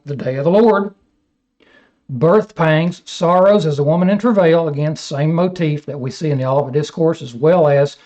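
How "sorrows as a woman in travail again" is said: ordinary delivery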